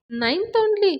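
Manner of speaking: can't believe it, rising high; shocked question. Acted. Loan officer stunned by mechanic's schooling, high disbelief. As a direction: pitch jumps far up in disbelief.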